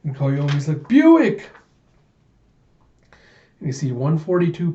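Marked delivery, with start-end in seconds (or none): 0.9-1.5 high, loud wail of a name; acted high-pitched shout; anguished cry. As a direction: cry out as if calling after someone lost. Grieving character cries out name.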